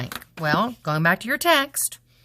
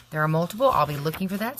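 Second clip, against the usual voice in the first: ordinary talk, nothing marked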